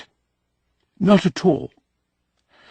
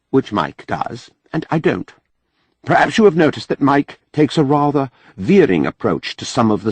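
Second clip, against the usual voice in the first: a hint of a higher, careful foreign scholar; lightly voiced Mahmud, earnest; 9 semitones higher than usual. A narrator's reading. Mahmud cautions Jubal not to joke with Mike, earnest. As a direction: voice Mahmud a touch higher, warning gently.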